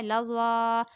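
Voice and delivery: लज़वा with "waa" held long; long final alif. Shown as a demonstration. Teacher giving an example, लज़वा with long ending. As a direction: draw out the last vowel of लज़वा. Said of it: "सिर्फ खड़ा ज़बर वक्फ़ की सूरत में पढ़ा जाता है".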